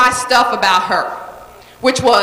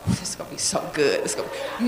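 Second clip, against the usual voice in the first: hushed excitement; 9 semitones lower, 11 dB quieter, faster than usual. Retold or imitated. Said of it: "I was like".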